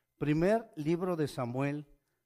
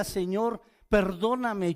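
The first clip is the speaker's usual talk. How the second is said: a hint of a tearful plea, louder and higher; imitated weeping plea; 6 semitones higher, 8 dB louder, slower than usual. Preacher voices a man begging God, mock tearful plea. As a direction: plead for forgiveness, lightly acted. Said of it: "lo vi llorando ... le decía".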